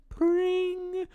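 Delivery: very high ringing "pring"; sing-out jingle sting noise; imitated music sting. Mimicking the commercial's closing sting, high and ringing. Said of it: "music, like, sting that comes in".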